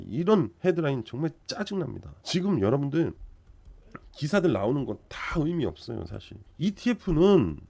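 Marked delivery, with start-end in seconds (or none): none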